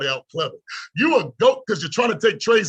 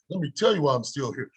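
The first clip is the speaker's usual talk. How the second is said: ordinary talk, nothing marked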